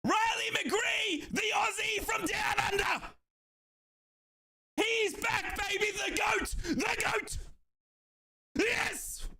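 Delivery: high, shouted fan roar over a goal; ecstatic goal celebration. Real, not acted. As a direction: scream it out like your team just scored.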